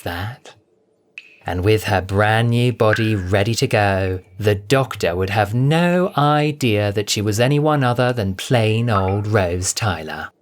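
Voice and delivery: usual speaking voice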